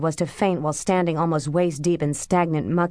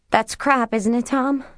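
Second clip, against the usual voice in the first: raised, pleading, higher voice; louder and higher; 7 semitones higher, 4 dB louder than usual. A narrator's reading. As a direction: call out for reassurance. Louder repeat, seeking reassurance.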